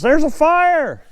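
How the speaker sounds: high, drawn-out alarm call imitating Dad; retold yell. Dad waking the house at two A M, imitated yell. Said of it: "Dad yells".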